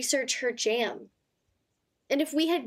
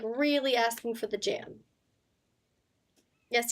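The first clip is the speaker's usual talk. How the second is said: normal talking voice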